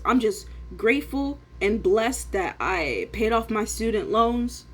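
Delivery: ordinary talk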